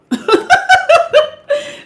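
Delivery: sudden high laugh; high delighted laugh; high burst of laughter. Real, not acted. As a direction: let out a high cackle. Laughs at own read.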